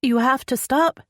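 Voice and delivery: urgent, high-pitched pleas to stop; panicked heroine, pitch jumping high; begging. A narrator's reading. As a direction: beg desperately for it to end. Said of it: "she begged".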